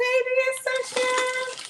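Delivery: very high, slow sing-song voice. Pretend. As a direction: float it up high and slow, delighted. Admiring the mango.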